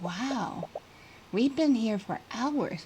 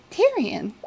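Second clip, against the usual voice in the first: normal talking voice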